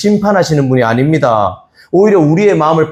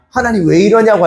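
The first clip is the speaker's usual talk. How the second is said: higher, whiny protest; mock complaint; 8 semitones higher than usual. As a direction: complain bitterly to God. Injured Cain complains to God. (억울한 항의)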